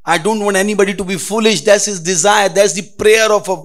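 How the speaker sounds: loud, high preaching. Acted. Preacher voicing wisdom's call to all, loud and high.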